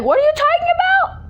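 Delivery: high, baffled outcry; confused. Retold or imitated. Confused by grandma's weird words, high incredulous squeak. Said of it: "And I'm like".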